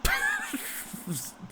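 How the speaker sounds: high squealed laugh; laughing at a name. Real, not acted. Player tickled by a footballer's name, high laughing squeal. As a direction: burst into a high-pitched, real laugh. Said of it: "I'm laughing at his name".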